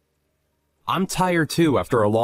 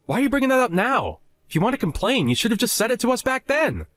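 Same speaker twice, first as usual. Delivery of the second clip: higher-pitched, indignant retort; annoyed comeback; 7 semitones higher than usual. Acted. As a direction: throw it back at them, pitched up. Husband snapping back in quarrel.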